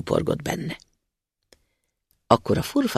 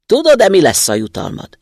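thundering giant spirit; 5 semitones higher, 8 dB louder than usual. Acted. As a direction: boom it out like a towering spirit. Spirit menacing the student.

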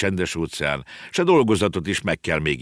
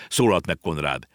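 plain reading voice

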